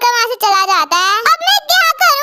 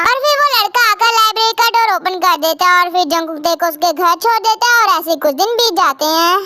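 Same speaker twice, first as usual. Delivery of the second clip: ordinary talk, nothing marked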